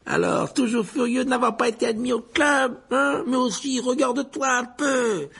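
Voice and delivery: high-pitched taunting figure; jeering ghost. Acted. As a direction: needle him in a high put-on voice. Head mocks Nick over club refusal.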